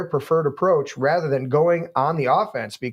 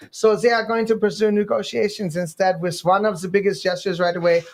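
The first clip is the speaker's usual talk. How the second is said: a hint of a German accent, a bit higher; mock German accent; 5 semitones higher than usual. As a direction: keep the fake German accent going. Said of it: "accent do we read it in?".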